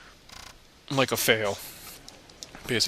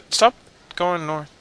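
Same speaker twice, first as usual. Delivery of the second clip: ordinary delivery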